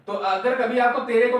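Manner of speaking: ordinary talk, nothing marked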